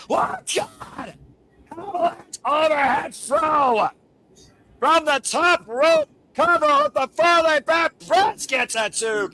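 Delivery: high, loud, slow excited call; wrestling commentator. Real, not acted. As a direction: shout the big spot like a commentator. Commentator calling a top-rope throw.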